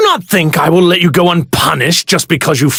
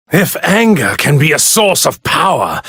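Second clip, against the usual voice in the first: normal talking voice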